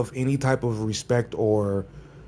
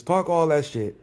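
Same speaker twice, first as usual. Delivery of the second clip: ordinary delivery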